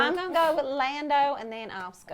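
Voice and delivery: put-on Texas accent. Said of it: "that is our awful accent".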